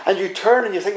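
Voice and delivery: usual speaking voice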